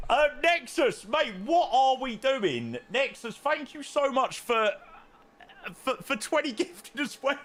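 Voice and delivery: high, playful mock complaint at a friend; high mock outrage. Real, not acted. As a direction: tease him with pitch up high. Mock-scolding donor, then thanking.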